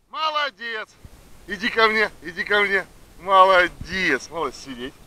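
high, sing-song praise to a dog; talking to a dog. Real, not acted. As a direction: praise her in a bright high voice. Praising dog coming back.

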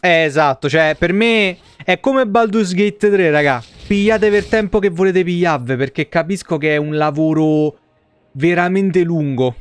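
usual speaking voice

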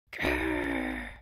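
deep rumbling growl; low growl. Acted. Allosaur trying its roar again, deep growl. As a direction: growl from the bottom of the chest.

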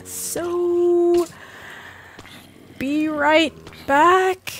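singsong sign-off. Acted. Signing off the video.

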